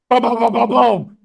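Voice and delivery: loud, high rapid-fire booms; imitated gunfire booms. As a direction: bang out mock gunshots with your voice.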